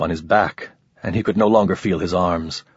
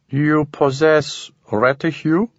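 slow, strange questioning alien; an unhurried inhuman creature; 6 semitones higher, slower than usual. A narrator's reading. Alien character voice.

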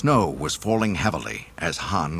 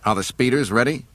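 snapped; 6 semitones higher, 5 dB louder than usual. Acted. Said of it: "Han snapped".

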